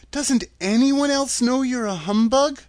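put-on a girl addressing a wizard; young heroine Dorothy. A narrator's reading. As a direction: lift the voice into a girl's earnest demand. Dorothy voiced in story.

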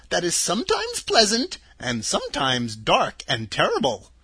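put-on a sweet little old woman, high and gentle; old witch of the North. Witch of the North telling Dorothy her home, high and kindly.

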